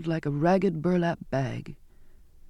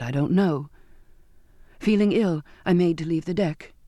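ordinary delivery

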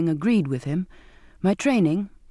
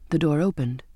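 ordinary delivery, nothing marked

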